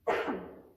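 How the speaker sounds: dry cough from dust in the throat. Real, not acted. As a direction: clear a dusty throat with a cough. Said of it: "I've just got dust in my throat".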